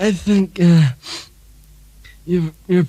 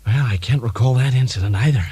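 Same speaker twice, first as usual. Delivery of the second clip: Ronald Reagan impression; 5 semitones lower than usual. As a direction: play a drowsy, forgetful Reagan. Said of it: "Ronald Reagan".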